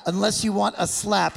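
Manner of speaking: hammed-up attempt at a British accent. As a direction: imitate a Brit's jokey threat, loud and amused. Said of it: "try to say it in your accent".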